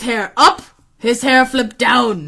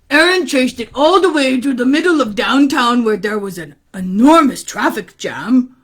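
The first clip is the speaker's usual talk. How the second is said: plain reading voice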